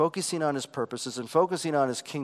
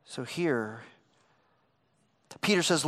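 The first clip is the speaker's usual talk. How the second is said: ordinary delivery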